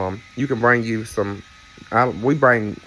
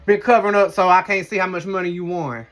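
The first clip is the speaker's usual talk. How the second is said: high mock scolding; playful accusing; 7 semitones higher, 4 dB louder than usual. Real, not acted. Teasing husband Eric about hiding casino winnings, mock scold. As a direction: press the accusation.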